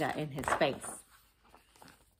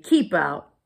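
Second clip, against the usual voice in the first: loud, gruffly indignant warning; storybook cactus yelling; grumpy yell; 3 semitones lower, 10 dB louder than usual. A narrator's reading. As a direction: warn off a trespasser loudly. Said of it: "yelled Hank".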